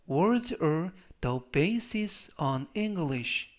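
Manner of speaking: very slow, evenly spaced words; slow demo reading. Shown as a demonstration. Teacher reads the example sentence slowly three times. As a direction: stretch it out for learners to repeat. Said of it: "역시 천천히 세 번씩 읽어드리고요".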